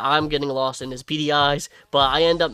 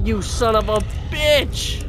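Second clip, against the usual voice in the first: slow, raised cry of betrayal; drawn-out curse; 6 semitones higher, slower than usual. Pretend. Abandoned player curses the villain, slow mock-angry cry. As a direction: stretch the insult out in mock fury. Cursing villain who left him.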